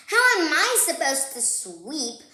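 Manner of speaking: a hint of an annoyed little sister; arguing sister. Sister arguing with Brother about his toys, mildly annoyed. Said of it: "argued Sister".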